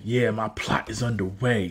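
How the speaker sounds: low, quiet villain voice; low scheming mock villain; evil villain. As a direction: play the evil boss, low and soft. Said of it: "Dragon being all evil".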